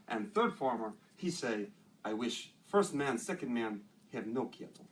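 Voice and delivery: Russian-accented joke-telling in broken English; measured storytelling with a Russian accent. Shown as a demonstration. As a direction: deliver the joke like a deadpan Russian. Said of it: "I got a Russian".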